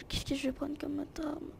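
hushed whisper while choosing a weapon. Real, not acted. Gamer whispering to viewers about picking a weapon. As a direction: breathe the question to yourself, unvoiced.

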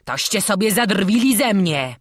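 trapped brigand bellowing insults; roaring robber. A narrator's reading. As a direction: roar at the tricksters. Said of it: "ryczał pod ziemią zbój".

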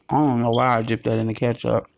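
chewing while talking; speaking around food; mouth full. Real, not acted. Seen 0: Bites and chews fried fish mid-phrase from 2.1s, mouth full.

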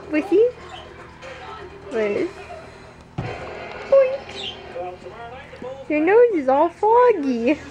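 high, sing-song talk to a pet; high playful pet voice. Real, not acted. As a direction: coo at the dog pinned under her. Baby talk to the dog.

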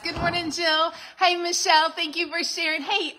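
bright, loud, high hello to chat viewers; cheerful loud welcome to livestream viewers; excited greeting. Real, not acted.

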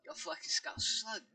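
rally them like a Scouser; put-on Liverpool accent; Scouse accent. Shown as a demonstration. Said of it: "he says you can do British accent".

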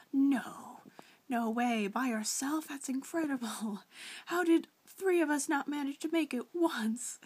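high, loud mock disbelief; incredulous reaction. Gamer reacting to a friend getting through Sacrifice alone, incredulous. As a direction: exclaim it, amazed, breaking into a laugh. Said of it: "I didn't believe it".

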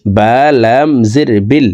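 ordinary delivery

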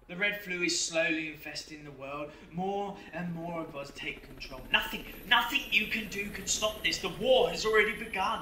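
gloating menace. Acted. Demon boasting to Kane, swelling triumph. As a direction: crow over the coming war.

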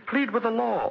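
high cruel laugh into a taunt; cruel mocking laugh. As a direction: laugh at his begging, then taunt. Villain mocks begging old man.

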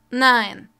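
sharp loud shouted no; sudden loud outcry. A narrator's reading. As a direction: burst out loudly, cutting him off. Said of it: "rief Malfoy plötzlich laut".

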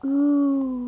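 ordinary talk

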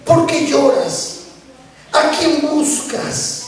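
drawn-out, gentle asking; slow, caring question from Jesus; slow tender question. Acted. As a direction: let each word hang, slowly. Jesus' question, slowly repeated.

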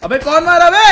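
high, very loud outburst; indignant shout. Real, not acted. Gamer demanding who shot him, indignant shout. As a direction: yell in sudden protest.

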